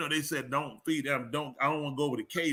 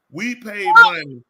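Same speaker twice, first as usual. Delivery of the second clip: loud mock complaint; 4 semitones higher, 13 dB louder than usual. Protesting that the fans paid, loud and high. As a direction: complain loudly.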